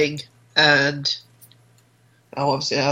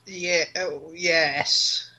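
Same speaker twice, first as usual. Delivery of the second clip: soft, reluctant yes; hesitant; 8 dB quieter, much slower than usual. Real, not acted. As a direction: agree quietly, as if unsure. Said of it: "You sounded a bit hesitant".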